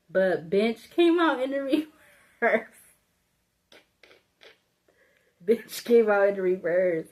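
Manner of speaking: giggling through the words; can't hold back the laughter; laughing. Real, not acted. Reader reading a card while cracking up, laughing. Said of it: "I can't stop laughing".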